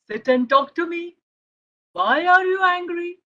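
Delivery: usual speaking voice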